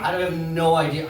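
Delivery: ordinary talk, nothing marked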